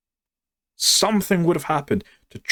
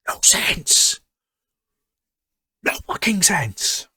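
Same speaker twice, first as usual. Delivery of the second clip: a hushed, fed-up aside; exasperated whisper; 7 semitones higher, slower than usual. Real, not acted. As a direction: whisper it in disbelief. Disbelieving aside after rant.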